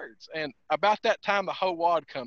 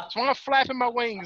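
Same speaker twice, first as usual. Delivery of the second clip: ordinary talk, nothing marked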